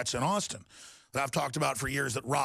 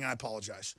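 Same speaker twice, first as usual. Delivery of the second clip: much softer, settling down; soft apology; 8 semitones lower, 7 dB quieter, faster than usual. Real, not acted. Host apologizing to listeners after ranting, softly. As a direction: drop the volume and apologize. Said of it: "I didn't mean to start ranting".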